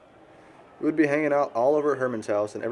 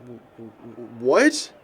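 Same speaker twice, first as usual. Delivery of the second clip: stunned disbelief; much slower than usual. Acted. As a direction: caught off guard, voice jumps up. Shocked by news of suicide.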